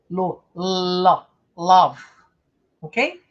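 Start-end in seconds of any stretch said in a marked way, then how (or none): 0.1-2.0 lo, lo, then love, stopping on the v; building to love. Shown as a demonstration. Teacher drilling love syllable by syllable, silent e. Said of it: "Não é lo".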